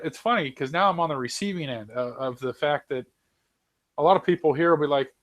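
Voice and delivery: usual speaking voice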